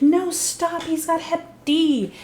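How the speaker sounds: high-pitched panicked stop, slowing on Hep D; urgent high warning. Retold or imitated. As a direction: yelp it out to stop him. Sookie urgently stops vampire.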